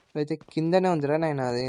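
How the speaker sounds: normal talking voice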